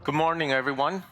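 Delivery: ordinary talk, nothing marked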